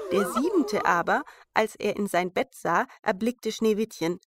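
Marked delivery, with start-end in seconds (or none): none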